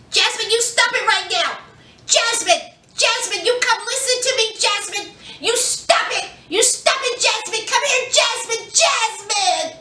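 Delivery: high, pleading mother calling her daughter back. Retold or imitated.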